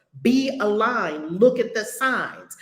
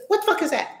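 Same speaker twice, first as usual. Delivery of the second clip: ordinary talk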